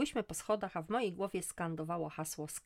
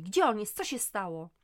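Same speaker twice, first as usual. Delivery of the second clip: louder frantic questions; exclaiming; 4 semitones higher, 7 dB louder than usual. Acted. Mother exclaims while dressing in panic. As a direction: cry out in panic. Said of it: "wykrzykiwałam".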